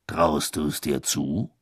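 low, slow, probing question; low and slow, sizing him up; Bagman voice. A narrator's reading. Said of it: "fragte Bagman".

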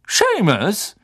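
high-pitched call. A narrator's reading. Teacher summons the next pupil, calling out high. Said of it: "rief Professor Lupin".

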